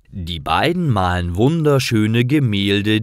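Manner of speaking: plain reading voice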